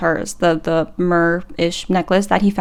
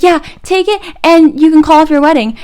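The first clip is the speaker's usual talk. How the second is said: put-on higher, louder eager teen girl; mock excited friend; excited pleading; 7 semitones higher, 6 dB louder than usual. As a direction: urge her to take it. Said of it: "And they're like".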